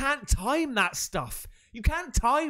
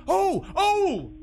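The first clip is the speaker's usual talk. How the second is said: two high, loud "oh"s; startled cries; 10 semitones higher, 4 dB louder than usual. Real, not acted. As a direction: jolt with alarm, twice. Startled by the video.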